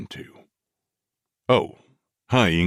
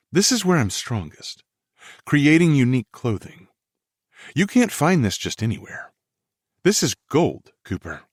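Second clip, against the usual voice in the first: lightly raised woman's voice; young woman voice; 7 semitones higher than usual. Acted. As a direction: voice her higher, eager. Female lead voiced by male narrator.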